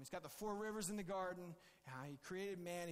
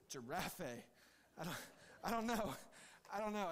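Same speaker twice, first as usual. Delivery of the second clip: flustered angel; 4 dB louder, slower than usual. Pretend. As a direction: throw up your hands, no idea how to spell it. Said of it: "the angel's like".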